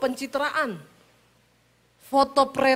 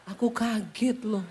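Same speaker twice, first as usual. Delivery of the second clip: calm Solo husband; 2 semitones lower than usual. Retold or imitated. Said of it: "Orang Soloi kaget laporan".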